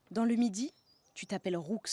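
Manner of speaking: ordinary talk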